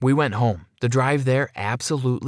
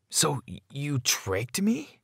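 high, soft, halting question; falter, then draw out the accusation in shock; stunned, slow, quietly wounded; hurt disbelief; 4 semitones higher, 5 dB quieter, slower than usual. A narrator's reading. Boy learns he was tricked.

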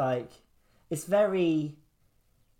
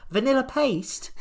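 incredulous; 10 semitones higher than usual. Real, not acted. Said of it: "incredulous repeat".

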